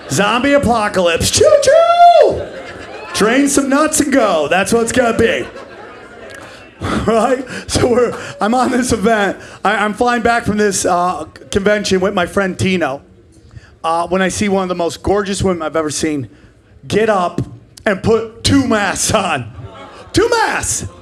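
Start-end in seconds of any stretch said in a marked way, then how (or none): 1.3-2.5 very high, loud choo-choo; train whistle call. Pretend. Comic mock train call to the ladies, high loud shout. 20.1-20.9 high incredulous cry; disbelieving outburst. Real, not acted. Comic shocked at a woman's two masks, high incredulous cry.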